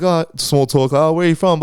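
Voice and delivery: a hammed-up polite chat opener; mock small talk.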